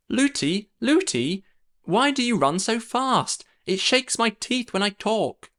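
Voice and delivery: put-on high, chattering young girl; little princess voice. A narrator's reading. Princess calls to her running nurse, high and chattering.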